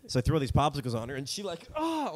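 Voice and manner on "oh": high, sudden tickled "oh"; involuntary yelp. Retold or imitated. Showing a reaction to being tickled, involuntary yelp. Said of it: "like, really involuntary".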